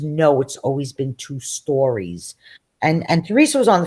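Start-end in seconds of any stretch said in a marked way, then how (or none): 0.0-2.3 mean cold reply. Retold or imitated. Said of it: "He looked at me with the meanest look".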